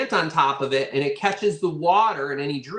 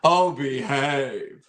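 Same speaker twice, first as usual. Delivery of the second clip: mock cheeky impression; 2 semitones lower, much slower than usual. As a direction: tease the audience like a cheeky movie spy. Joke after pasties laugh.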